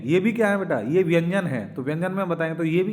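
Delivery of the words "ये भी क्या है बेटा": normal talking voice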